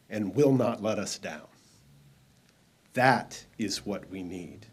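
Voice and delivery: usual speaking voice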